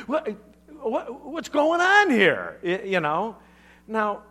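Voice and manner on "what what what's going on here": mock confused. Mock puzzled reaction.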